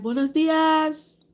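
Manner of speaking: high, loud, slow sing-song greeting; coworker's cheerful greeting. Retold or imitated. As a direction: mimic her bright morning hello. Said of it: "she like".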